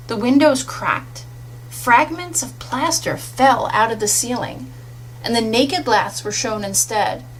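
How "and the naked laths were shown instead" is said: plain reading voice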